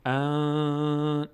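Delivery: ان sung through the nose with the tongue idle; nasal ghunna on an. Shown as a demonstration. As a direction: hum the n through the nose, held. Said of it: "صدا از بینی میاد".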